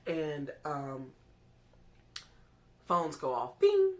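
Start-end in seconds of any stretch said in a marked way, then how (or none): 3.6-3.9 very high, very loud bing; phone notification ding. Retold or imitated. Imitating the phone going off, a very high loud bing.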